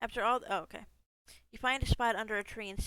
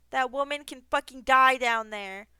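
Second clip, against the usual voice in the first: loud, raised panicky words; loud rattled declaration after a scare; 4 semitones higher, 10 dB louder than usual. Real, not acted. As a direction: shaken and fed up, say it loud. Panicked reload after death.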